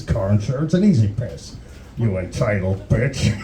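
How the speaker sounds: low horror-villain menace; Freddy Krueger. Acted. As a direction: threaten like a movie killer toying with his victim. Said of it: "Freddy Krueger par-, uh, popping out going".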